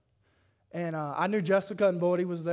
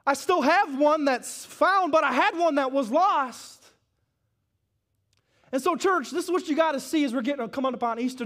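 higher, louder, hard-driving sermon cadence; preaching at full force; 5 semitones higher, 4 dB louder than usual. Real, not acted. Pastor urging the church to seek the lost, full-force preaching. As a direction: preach it out to the congregation.

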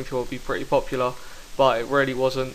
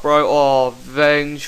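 usual speaking voice

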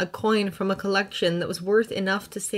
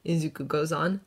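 ordinary delivery

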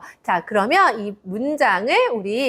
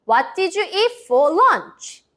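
very slow question pitched high; slow, high model question; 6 semitones higher, much slower than usual. Shown as a demonstration. Teacher modelling the question for kids to repeat. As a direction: spell the question out slowly. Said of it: "큰 소리로 따라해 볼까요".

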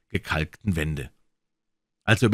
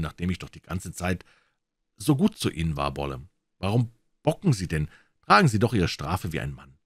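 usual speaking voice

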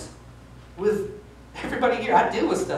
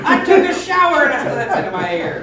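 much higher and louder, laughing; laughing outburst; exasperated laugh; 7 semitones higher, 8 dB louder than usual. Real, not acted. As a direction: burst out in disbelief. Comic story, exasperated.

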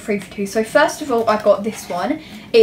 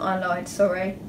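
ordinary delivery

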